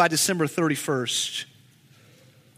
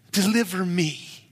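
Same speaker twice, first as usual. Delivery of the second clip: ordinary talk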